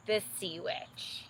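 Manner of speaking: plain reading voice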